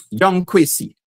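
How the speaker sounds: normal talking voice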